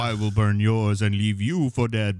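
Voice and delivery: villain line. Retold or imitated. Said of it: "too on the nose".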